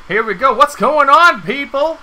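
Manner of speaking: loud, high hype opener; hype intro. Acted. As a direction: pump up the crowd like a host.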